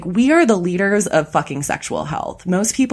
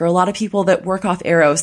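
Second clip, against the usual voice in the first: ordinary talk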